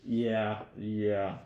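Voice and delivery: normal talking voice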